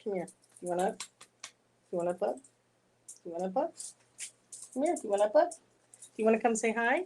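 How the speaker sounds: sing-song high coaxing to a little dog; coaxing pet talk. Real, not acted.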